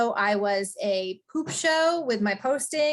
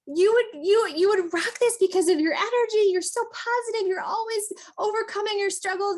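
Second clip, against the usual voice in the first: high, pushy, excited pitch to a friend; genuine gushing enthusiasm; 9 semitones higher than usual. Acted. Coach demonstrates inviting a friend to her team, gushing and confident. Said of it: "but it's genuine".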